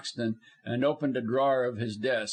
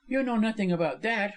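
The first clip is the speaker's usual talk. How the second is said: higher, controlled woman's voice; composed defiance; steady retort; 9 semitones higher than usual. A narrator's reading. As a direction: deny it firmly. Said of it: "but in a steady voice".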